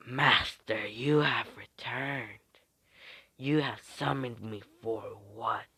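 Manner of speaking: slow, whispery snake voice; servile summoned snake. Acted. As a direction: whisper slowly to your master as a snake.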